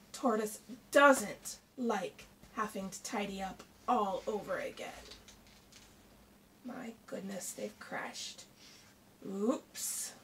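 plain reading voice

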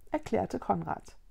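ordinary delivery, nothing marked

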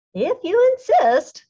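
high, loud, slow reply; smug skunk ready to spray. A narrator's reading. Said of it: "said the skunk".

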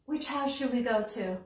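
ordinary delivery

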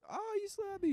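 very high mock-hurt woman's voice; slapped woman. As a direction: squeal it as an imitated wounded woman. Retold slapped woman in the movie.

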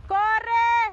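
urgent high yell; shouting run. Real, not acted. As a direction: call out to them to run. Yelling at migrants to run.

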